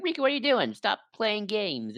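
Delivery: high, mildly scolding call; Sora. Retold or imitated. Sora confronting Riku, high retold reproach. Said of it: "Sora's like".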